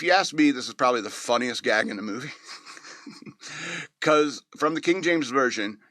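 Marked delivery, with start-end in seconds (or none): 2.4-4.0 soft unvoiced laugh; breathy laugh. Real, not acted. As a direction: laugh quietly at the joke. Laughing at funniest gag.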